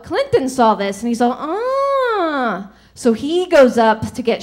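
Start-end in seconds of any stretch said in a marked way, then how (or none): 1.4-2.7 long, high "ah" of catching on; comic lightbulb moment, pitched up; dawning realization. Acted. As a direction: let the idea dawn in a drawn-out high note. Clinton catches on to the trick.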